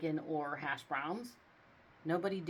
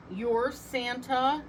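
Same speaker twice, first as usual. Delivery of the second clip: louder, slow skeptical judge; judge interrupting; 5 semitones higher, 6 dB louder, slower than usual. A narrator's reading. Judge interrupting Santa, slow and skeptical. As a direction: cut him off in disbelief. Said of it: "the judge interrupted".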